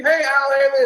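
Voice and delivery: high, loud mock call across the room. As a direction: hail him like a fan spotting a star. People calling out to Sam.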